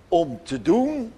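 normal talking voice

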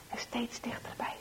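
whispered "steeds dichterbij". A narrator's reading. Narrator whispering the boys' creeping approach. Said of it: "repeated whispered phrase".

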